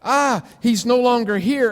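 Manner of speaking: the square panics when the sphere vanishes, in mock alarm. Mildly acted panic. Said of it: "the square is panicked".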